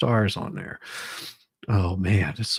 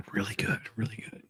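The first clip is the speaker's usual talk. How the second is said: quick, breathy whisper after a gulp of water; hushed, satisfied whisper; 9 dB quieter, faster than usual. Real, not acted. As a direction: whisper it to yourself, savouring the drink. Savouring a drink of water on stream.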